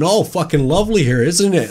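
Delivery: high, loud American tourist voice; chirpy, cheerful American accent. Retold or imitated. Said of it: "That was a lovely American accent".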